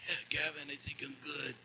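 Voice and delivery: weak reply from the floor. Retold or imitated. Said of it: "and I'm like".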